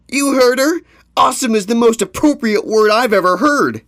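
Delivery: loud, high-pitched elated voice; excited praise. Acted. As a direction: shout your wholehearted agreement. Another pony joins the cheering.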